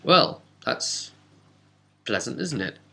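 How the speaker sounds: usual speaking voice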